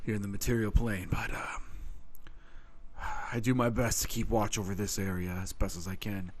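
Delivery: low, soft, steady explanation; a hint of a solemn guardian centaur; lightly voiced centaur.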